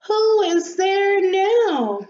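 high, loud, drawn-out question; exasperated call. Acted. Rosebud calls to the new visitor, exasperated. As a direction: call out slowly in indignant exasperation. Rosebud disturbed while resting.

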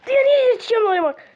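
sudden loud, high, fast angry yell; real frustration shouted fast and high; angry outburst. Real, not acted. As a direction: blow up at the enemy player. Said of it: "Çok sinir oldum ha sana".

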